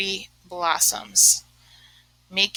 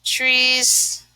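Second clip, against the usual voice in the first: ordinary talk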